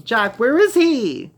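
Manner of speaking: put-on boy shouting to his parents where his brother is; frantic shout. A narrator's reading. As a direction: call out in panic for the missing brother.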